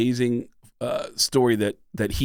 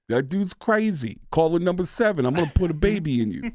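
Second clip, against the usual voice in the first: Tracy Morgan impression; 4 semitones higher than usual. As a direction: do Tracy Morgan with a loopy lilt. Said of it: "is Tracy Morgan".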